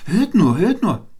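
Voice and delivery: high, loud excited call. A narrator's reading. Wren summons the other birds, calling out. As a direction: call everyone to listen, pitched right up. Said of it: "rief er".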